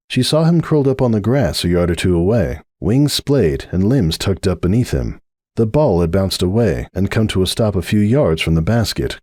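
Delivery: ordinary delivery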